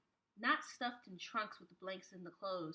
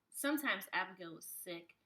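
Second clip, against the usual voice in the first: ordinary delivery, nothing marked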